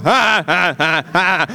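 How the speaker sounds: string of wordless farmer grunts; mock grunting, one noise after another; imitated grunts. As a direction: answer only in repeated "ah" noises. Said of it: "er hat einfach Geräusche gemacht".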